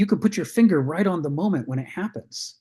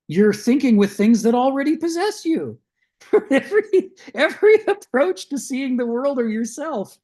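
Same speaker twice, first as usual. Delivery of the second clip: higher, louder, breaking into laughter; laughing while talking; 8 semitones higher, 4 dB louder than usual. Real, not acted. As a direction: let real laughter spill into the point. Laughs while making the startling point.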